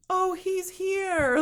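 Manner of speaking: high, slow, drawn-out announcement; mock obvious dialogue. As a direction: ham up the painfully obvious line. Acts out film's very obvious line.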